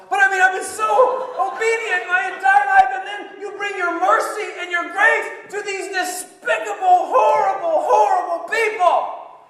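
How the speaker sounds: high, loud furious tirade; indignant rant. Acted. Jonah protesting God's mercy to sinners, furious shouting. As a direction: hurl every accusation at God. Ranting at God over mercy.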